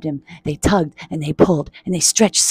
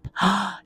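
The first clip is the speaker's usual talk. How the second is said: quick startled gasp; sharp intake of breath, acted; storybook gasp; 2 semitones higher, 6 dB quieter than usual. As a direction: gasp at one's reflection. Gasp at seeing reflection.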